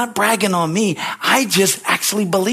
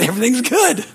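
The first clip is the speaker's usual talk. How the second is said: laughing; 4 semitones higher than usual. Real, not acted. Laughing at own story.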